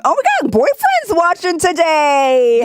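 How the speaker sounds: excited. Real, not acted. Boyfriend joined the stream, excited.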